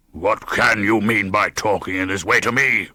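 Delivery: a hint of savage fury; understated, menacing rebuke to the guest; savage thunder. A narrator's reading. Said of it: "thundered Heathcliff with savage vehemence".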